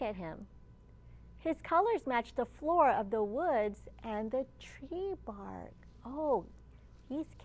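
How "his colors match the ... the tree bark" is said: plain reading voice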